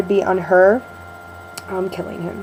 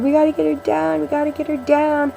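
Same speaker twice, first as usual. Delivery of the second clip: imitated crying child; 7 semitones higher than usual. Nanny mimics crying five-year-old begging for the cat's rescue, imitated crying. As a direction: imitate an upset little kid pleading in a high voice. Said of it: "she's crying, and she's like".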